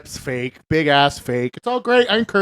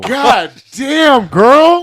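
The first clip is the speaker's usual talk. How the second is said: high, loud amazed outburst; mock amazement at her age; stunned exclaim; 8 semitones higher, 9 dB louder, much slower than usual. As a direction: let it burst out in disbelief.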